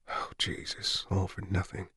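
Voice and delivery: breathless whisper of dread; barely voiced, hopeless; whispered oh Jesus in despair. Despairing inner thought.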